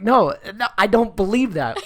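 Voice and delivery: loud fed-up no; mock exasperated. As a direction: push back hard. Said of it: "It's like".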